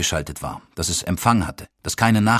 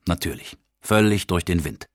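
ordinary delivery

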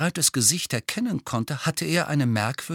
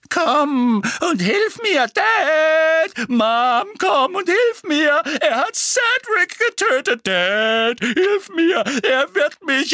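very high, loud shrieked plea; mocking wail; 7 dB louder, slower than usual. A narrator's reading. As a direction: cry for Mom and Dad in mock terror. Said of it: "nahm eine spitze, wimmernde Stimme an".

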